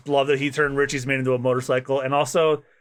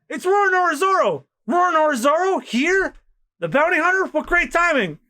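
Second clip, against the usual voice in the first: much higher, thrilled exclamations; excited crowd; 3 dB louder than usual. Acted. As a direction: gasp at the famous hunter. Excited crowd spots Zoro.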